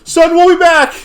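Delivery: very high, loud call; hammed-up retreating cop; mock hurried shout. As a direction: shout it while running off. Voicing fleeing cop.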